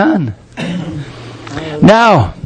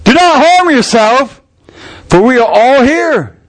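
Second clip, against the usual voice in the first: loud cry; 8 semitones higher than usual. A narrator's reading. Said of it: "Paul cried out with a loud voice".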